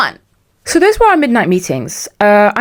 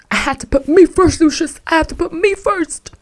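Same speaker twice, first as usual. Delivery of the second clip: high, theatrical put-on voice; hammed-up self-defence; 10 semitones higher than usual. Host justifying walking out on the boss, mock-dramatic high cry. Playful quote to justify herself.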